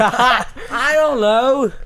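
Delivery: goofy. Pretend. Goofy drawn-out reply amid laughter.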